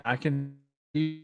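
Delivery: distorted robotic call sound; buzzy glitchy call audio. Real, not acted. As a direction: voice breaking up over the line. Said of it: "you don't sound good still".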